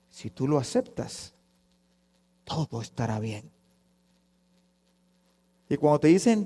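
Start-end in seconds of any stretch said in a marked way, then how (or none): 0.1-3.5 very soft, low assurance; soothing promise. Retold or imitated. Preacher mimics easy-gospel promise to newcomer, hushed soothing. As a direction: lull the listener with hushed promises.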